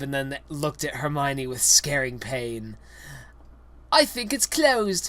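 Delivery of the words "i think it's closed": pitched well up, a bit louder; squeaky puzzled kid; high character voice. Acted. Reader voices Ron at the locked door, high.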